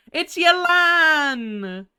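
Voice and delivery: high, loud, stretched-out name; triumphant shout. Real, not acted. Streamer finding the answer at last, triumphant drawn-out shout. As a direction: drag the answer out in a gleeful yell.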